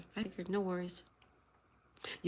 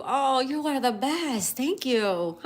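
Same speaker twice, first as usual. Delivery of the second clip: higher, louder, slow grateful gush ending in a high laugh; overjoyed at a gift; thrilled thanks; 6 semitones higher, 6 dB louder, slower than usual. Real, not acted. Viewer made her a magnet skull, thrilled grateful gush.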